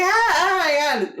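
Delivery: high, loud, stretched-out squeal; so excited. Retold or imitated. Drunk ladies waiting for the star, squealing excitedly. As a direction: wail it like an overwhelmed fan. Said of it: "These ladies are so excited".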